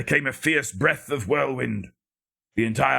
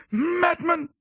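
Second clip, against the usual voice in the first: high piercing shriek. A narrator's reading. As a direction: scream it with everything. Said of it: "shrieked out his syllables".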